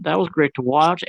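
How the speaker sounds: ordinary talk, nothing marked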